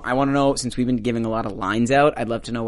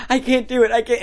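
mock panicked; 10 semitones higher than usual. Retells character stuck, giving up.

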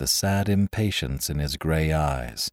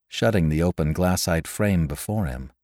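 ordinary delivery

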